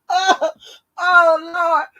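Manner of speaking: high, loud wail after a laughing fit; recovering from laughter. Real, not acted. Worn out after laughing hard.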